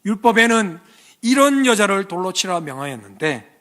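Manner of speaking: loud, high public accusation; accusing Pharisees. Acted. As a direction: challenge Jesus before the crowd. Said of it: "예수님께 질문합니다".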